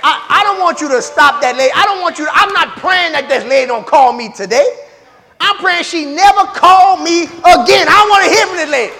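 loud, rising, pleading prayer; a churchgoer praying loudly to God; mock bold prayer. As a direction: pray it big and demanding. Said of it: "you come up in there and you say".

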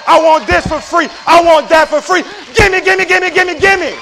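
high, loud mimicked begging; mock greedy demanding. Preacher mimics people wanting everything free, shouted demands. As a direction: pile up demands like a greedy complainer. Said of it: "That's what it sounds like".

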